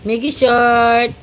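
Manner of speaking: ordinary delivery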